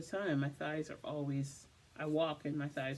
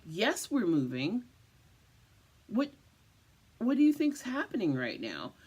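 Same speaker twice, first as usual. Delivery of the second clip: sarcastic retort; 5 semitones higher, 6 dB louder than usual. Retold or imitated. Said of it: "And I'm like".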